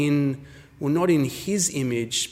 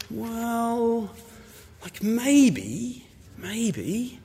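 high, soft, drawn-out reluctance; hesitant maybe; 7 semitones higher, 5 dB quieter, much slower than usual. Acted. Woman answering a marriage proposal, hesitant and noncommittal. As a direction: stall with a high, noncommittal hedge.

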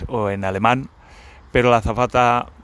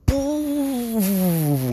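drawn-out puff; 12 semitones higher than usual. Retold or imitated. Volcano of anger exploding inside.

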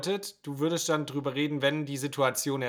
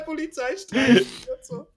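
laughing; 12 semitones higher, slower than usual. Real, not acted. Joking while laughing.

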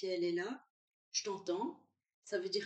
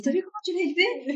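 much higher and louder teasing quote; playful mock accusation, pitched way up; husband's mock reproach; 11 semitones higher, 12 dB louder than usual. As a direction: throw it out like an amused husband needling his wife. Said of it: "Mon mari, il a dit".

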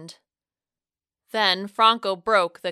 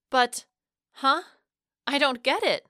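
higher, halting, baffled questioning; stuttered confusion; 6 semitones higher than usual. A narrator's reading. Narrator reacting to Franco's plan, stuttering in confusion. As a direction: stammer in mild bewilderment, pitch raised. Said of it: "I stuttered".

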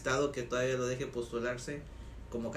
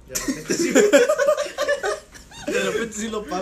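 laughing through the words; giggling as he answers; 8 dB louder than usual. Real, not acted. Laughing while answering about pesos.

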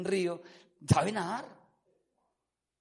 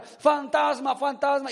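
higher, louder acted alarm; mock shouting; 5 semitones higher, 5 dB louder, slower than usual. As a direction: cry out like spooked disciples, acted. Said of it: "comenzaron a gritar". (gritos de alarma imitados)

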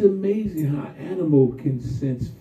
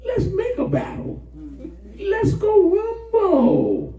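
high, slow eager battle cry; slower than usual. Retold or imitated. David itching to fight, high and drawn out. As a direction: stretch out the call to rumble.

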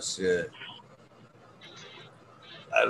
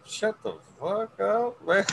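mock self-scolding; 10 semitones higher than usual. Retelling his scolding of himself.

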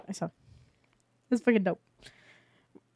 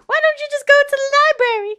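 high cheery salesman voice; 12 semitones higher, 4 dB louder than usual. Acted. Guard voice.

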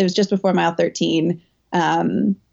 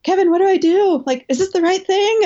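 panicked plea; 12 semitones higher than usual. Retold or imitated. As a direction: ask in a rush of panic, pitched way up. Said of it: "like, in a panic".

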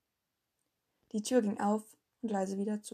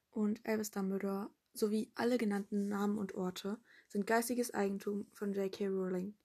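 ordinary delivery, nothing marked

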